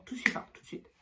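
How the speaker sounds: urgent whispered insistence, breathy no voice; whispered demand. Retold or imitated. Retelling her demand to the nurse, whispered and insistent. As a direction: hiss it out like a pushy aside.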